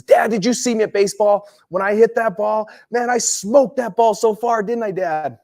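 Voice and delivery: put-on high, bright-eyed kid voice calling to Dad; excited young son. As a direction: play the eager little boy bragging about baseball. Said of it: "And he says".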